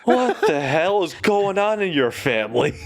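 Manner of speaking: high-pitched mock bewilderment; incredulous. Friend staring at Dane over his family, incredulous. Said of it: "I'm just staring at".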